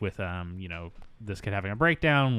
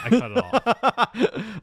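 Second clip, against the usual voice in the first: laughing; 12 semitones higher, 6 dB louder, much slower than usual. Real, not acted. Laughing about failed accent.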